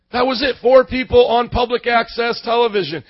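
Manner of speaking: normal talking voice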